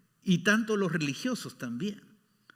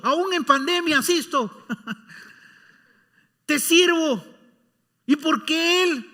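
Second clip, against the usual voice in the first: mock the believer who thinks he deserves more; comic complaining believer; 8 semitones higher, 8 dB louder than usual. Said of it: "Lo pongo de forma cómica". (queja cómica de creyente, cada vez más fuerte y aguda)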